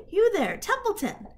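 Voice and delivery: high, loud call across the yard. Acted. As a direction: call the rat from afar. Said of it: "he called".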